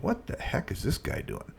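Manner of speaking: under his breath, in disbelief; whispered to himself. Retold or imitated. Watching the stranger draw charts, whispering in disbelief. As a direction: whisper it like a private thought.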